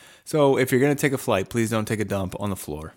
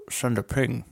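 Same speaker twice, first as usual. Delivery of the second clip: Sean Connery voice. Retold or imitated. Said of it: "no Russian accent whatsoever".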